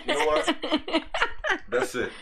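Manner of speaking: high, loud, laughing mock outrage; laughing outburst. Real, not acted. Streamer laughing as he calls off the stream, mock outrage. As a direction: throw up your hands, laughing, and quit.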